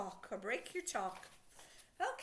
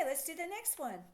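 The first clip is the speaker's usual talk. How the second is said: ordinary delivery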